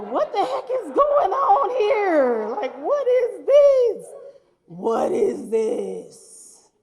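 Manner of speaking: slow, drawn-out baffled cry; mock bewildered neighbor. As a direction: stretch out a neighbor's confusion at the hearse.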